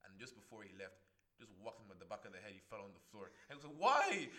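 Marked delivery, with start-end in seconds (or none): 3.8-4.3 high loud pained cry; high yelp. Retold or imitated. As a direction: cry out in shock. Junior cries out after whack.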